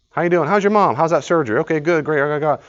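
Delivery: mock churchgoer chatter; chirpy small talk. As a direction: gush through the polite questions. Acting out church greeting ritual.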